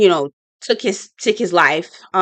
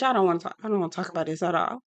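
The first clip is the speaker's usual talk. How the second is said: hushed; 3 semitones lower, 11 dB quieter, much faster than usual. Retold or imitated. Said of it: "Crystal's like".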